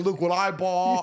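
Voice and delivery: hammed-up flashy bragging; mock show-off. Him picturing himself showing off the painting, mock brag. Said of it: "like a dumbass".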